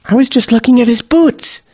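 usual speaking voice

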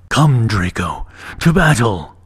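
rally him into battle; dragon battle call. A narrator's reading. Dragon calling the boy to battle.

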